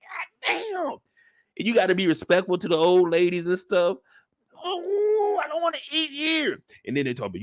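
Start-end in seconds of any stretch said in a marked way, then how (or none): 0.0-1.0 high whiny groan; a kid dreading church food; kid's groan. Retold or imitated. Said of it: "You're like". 4.6-6.6 high fake-crying whine; whiny kid. Kid whining about church food.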